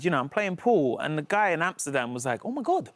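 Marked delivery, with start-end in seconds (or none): 2.5-2.9 put-on high, excited Dutch-accented greeting. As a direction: play an Amsterdam pool player spotting a star in a Dutch accent. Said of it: "was that your Dutch accent".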